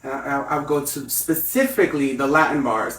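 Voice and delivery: usual speaking voice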